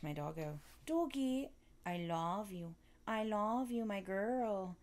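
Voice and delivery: soft, lilting pet-talk voice; cooing to a dog. Real, not acted. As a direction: coo lovingly at your dog.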